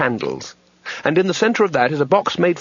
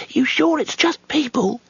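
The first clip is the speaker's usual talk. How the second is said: much higher, timid voice; nervous; 13 semitones higher than usual. A narrator's reading. Youngest wizard worries about the cottage, nervous and high. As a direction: squeak it out anxiously. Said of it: "said the youngest wizard nervously".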